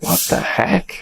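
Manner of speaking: hushed, breathless disbelief; stunned whispered aside; incredulous whisper. Retold or imitated. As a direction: whisper it like you can't believe your eyes. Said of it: "I was like".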